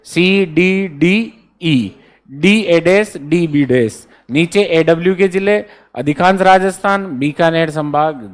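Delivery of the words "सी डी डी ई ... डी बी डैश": slow spelling out. Shown as a demonstration. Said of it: "कैसे?".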